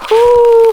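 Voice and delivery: loud gasp in icy water; sharp whew from the cold shock. Real, not acted. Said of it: "the best time for a bath".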